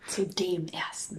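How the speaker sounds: unvoiced whispered aside. Real, not acted. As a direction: whisper the correction. Correcting grammar teasingly.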